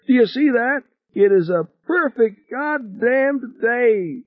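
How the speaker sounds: very high, ecstatic cry, stretched at the end; elated screaming. A narrator's reading. Passenger screaming in pilot's ear on surfacing, high and elated. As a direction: exult high, drawing out the last words. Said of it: "screaming in Norman's ear".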